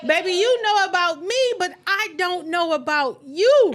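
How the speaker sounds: pressing him, voice climbing as she talks; retold confrontation. Confronting her man about the secret, high and pressing.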